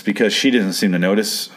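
ordinary talk, nothing marked

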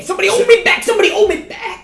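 high, fast hammy fury; mock rage. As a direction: pretend to be held back. Mock rage at low score.

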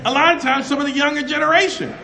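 loud, high preacher shouting with laughs; preaching at full force. Acted. Preacher rallies his congregation in a loud, high shout. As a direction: fire it at the crowd like a revival.

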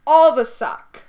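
loud, punchy verdict; emphatic shout. Real, not acted. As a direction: slam the verdict home.

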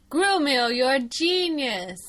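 Grumio, you're a genius with a sweet lisp; cute little lisp on genius; lispy adorable voice. Retold or imitated. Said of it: "he says it like Edwin, so it's, like, lispy and adorable".

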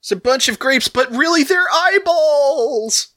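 high, slow, wailing spooky voice; spooky Halloween voice. Retold or imitated. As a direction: wail it like a haunted house host. Spooky Halloween host impression.

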